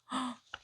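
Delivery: short soft gasp of dismay; story gasp. Acted. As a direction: gasp as the blanket snags. Snag in the story.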